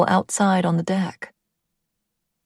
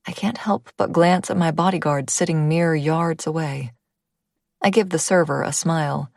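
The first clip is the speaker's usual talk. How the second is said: plain reading voice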